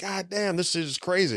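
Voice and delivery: high, hushed retold amazement; amazed. Retelling his amazed reaction at the club.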